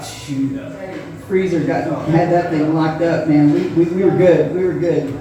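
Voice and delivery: normal talking voice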